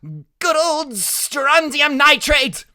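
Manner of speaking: high, loud, stretched-out cry; boy shouting in high-pitched delight; excited shout. A narrator's reading. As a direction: call it out gleefully at the fireworks. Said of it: "Freak keeps on shouting out".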